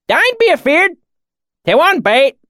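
loud, high swineherd holler in dialect; rustic shout. A narrator's reading. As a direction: call out reassurance in a thick country accent. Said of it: "he shouted".

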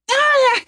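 high yelp of pain; pained cry. A narrator's reading. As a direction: cry out high as arm twists. Arm twisted.

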